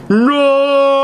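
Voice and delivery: huge, very high and loud shriek; shout. A narrator's reading. Dumbledore cutting off the reveal with a huge shrieking shout. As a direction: blast the no out at the top of the voice. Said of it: "he shouted".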